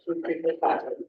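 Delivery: ordinary talk, nothing marked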